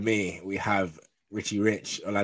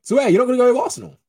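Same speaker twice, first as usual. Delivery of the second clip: surprised; 11 dB louder, faster than usual. Real, not acted. Host surprised at guest's pick.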